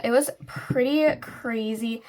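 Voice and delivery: ordinary talk, nothing marked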